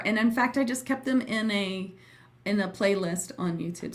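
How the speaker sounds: ordinary delivery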